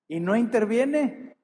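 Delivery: mock despair on no interviene, drawn out high; anguished complaint. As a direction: cry it out like a hurt believer. Voicing a sufferer's complaint.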